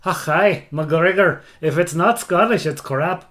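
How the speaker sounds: put-on loud, gruff-joking Scottish accent; hammed-up Scottish outburst. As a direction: belt it out like a proud Scot. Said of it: "Decent accent, decent accent".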